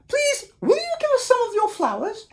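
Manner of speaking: high anxious tiny voices; worried little wolves. Acted. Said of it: "asked the little wolves".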